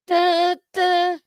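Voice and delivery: high, loud sung-out "da, da" in dancehall style; dancehall horn noise. Acted. Host showing how they go, mock dancehall horn. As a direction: imitate a Jamaican dancehall horn. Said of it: "go into a Jamaican dance".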